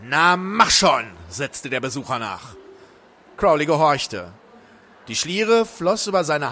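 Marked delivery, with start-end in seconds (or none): 0.0-1.1 slow, loud prodding; impatient urging. A narrator's reading. Visitor to hesitant Crowley, impatient urging. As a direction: draw it out impatiently.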